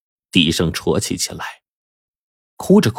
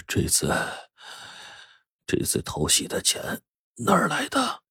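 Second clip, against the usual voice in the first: barely audible whisper of a sick man; weak, feeble; 11 dB quieter than usual. A narrator's reading. Patient asks his wife about dialysis money, weak whisper. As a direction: ask faintly, halting, voice almost gone. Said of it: "弱弱地问妻子说".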